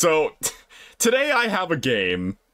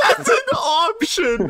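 laughing; 14 semitones higher than usual. Real, not acted. Laughing at an absurd option.

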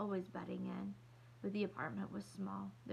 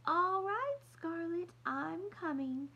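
much higher, louder, slow grand-lady voice; sophisticated voice; 10 semitones higher, 5 dB louder, slower than usual. Acted. Girl playing Scarlett for her sister's doll, high and grand. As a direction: play an elegant society lady. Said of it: "in a sophisticated voice".

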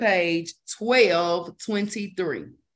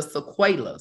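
usual speaking voice

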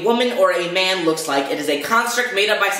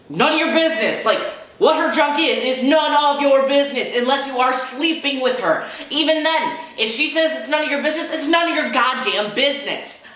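higher-pitched, worked-up rant; indignant, insistent telling-off; heated indignation; 7 semitones higher than usual. Real, not acted. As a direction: argue it hard, pitch riding high.

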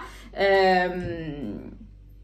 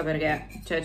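normal talking voice

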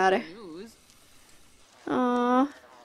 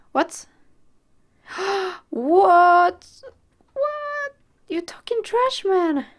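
shocked; 8 semitones higher, slower than usual. Real, not acted. Shocked outburst at game.

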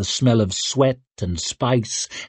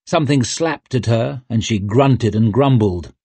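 ordinary delivery

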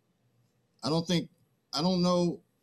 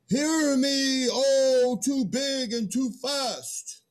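mock grand proclamation; 6 semitones higher, much slower than usual. Man mocks a grand call.

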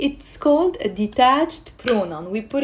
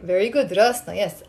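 normal talking voice

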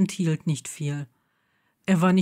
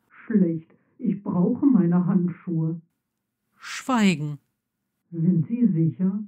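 lost armor; slower than usual. Acted.

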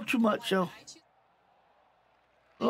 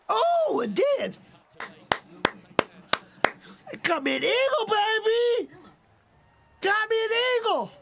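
excited shouting; 5 semitones higher than usual. Real, not acted. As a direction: celebrate the eagle at full voice. Celebrating an eagle on stream.

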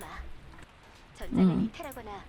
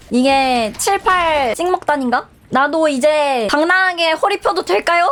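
ordinary talk

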